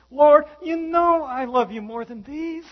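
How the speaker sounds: high, loud, slow plea; pleading Peter. Retold or imitated. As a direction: beg to be believed. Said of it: "Peter says".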